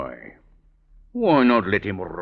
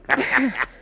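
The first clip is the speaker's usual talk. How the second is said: sly cackle; 3 dB louder than usual. Acted. Captain cackles.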